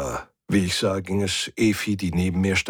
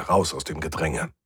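ordinary delivery, nothing marked

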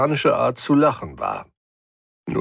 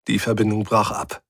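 ordinary delivery, nothing marked

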